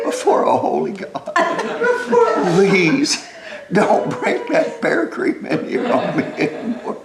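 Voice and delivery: breathless laughing beg, slow; laughing plea. Retold or imitated. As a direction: plead through helpless laughter. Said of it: "I said".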